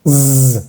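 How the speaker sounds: long voiced zzz like a little bee; vibrating Z buzz. Shown as a demonstration. Teacher imitates a little bee, buzzing Z. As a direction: hold a buzzing mosquito Z. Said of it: "una abejita".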